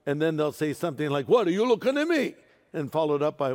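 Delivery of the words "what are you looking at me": put-on New York accent. A preacher imitating a New Yorker, fast tough New York accent. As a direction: snap it like a gruff New Yorker. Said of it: "In New York City".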